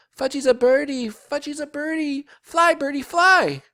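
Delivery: piping shouts of a child playing bird; excited toddler. A narrator's reading. Toddler playing bird atop jungle gym.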